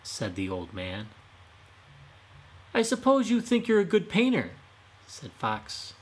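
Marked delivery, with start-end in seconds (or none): 2.7-4.5 Fox needling him, pitched way up; taunting fox. Acted. Fox taunting the painter, high and mocking.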